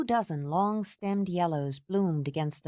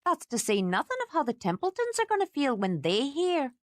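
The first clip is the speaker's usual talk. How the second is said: higher, building scolding lecture; lecturing housekeeper; 9 semitones higher than usual. A narrator's reading. Housekeeper lecturing Kate about worried family, rising and scolding. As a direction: pile on the guilt.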